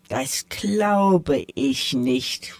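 purring cat. Acted. The tomcat teases the mice, slow sly purr. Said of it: "schnurrte da eine Stimme".

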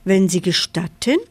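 ordinary delivery

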